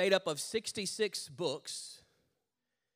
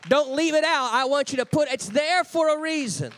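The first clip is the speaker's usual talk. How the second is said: God insisting; 5 semitones higher, 8 dB louder than usual. Retold or imitated. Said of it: "And God goes".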